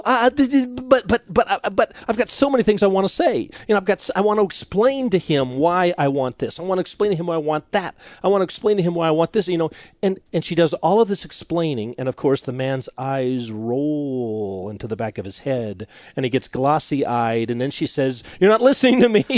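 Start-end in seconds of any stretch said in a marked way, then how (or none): 0.0-9.5 higher, stammering, rushed imitation; flustered over-explaining. Wife insisting she must explain, imitated as flustered and rushed. 18.4-19.2 high, loud imitated protest; mock wifely complaint. Wife to glassy-eyed husband, mock exasperated complaint.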